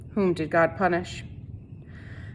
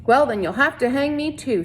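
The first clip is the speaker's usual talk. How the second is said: defiant retort; 6 semitones higher than usual. A narrator's reading. Catherine defying sheriff.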